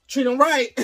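high, loud call to the cops; bystander warning. Retold or imitated. Woman with camera warning the cops, loud. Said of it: "She like".

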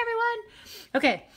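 normal talking voice

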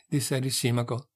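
usual speaking voice

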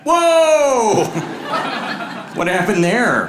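loud, high drawn-out whoa; shocked dad. Acted. Dad interrupting his son's sledding story, shocked. Dad's shocked reaction, acted.

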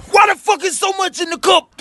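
high, loud outraged yell; mock furious ex. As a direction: screech like a petty girlfriend. Ex overreacting over juice.

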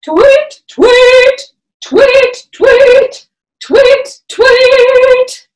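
high, loud bright tweets; mama bird call. Pretend. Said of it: "come into our mama bird".

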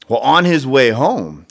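ordinary delivery

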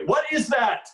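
high, loud mock astonishment; surprise-party shock. Mocking a senator's surprise-party look, high loud astonishment. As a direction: exclaim it with exaggerated surprise. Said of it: "always look like, uh, he's attending a surprise birthday party".

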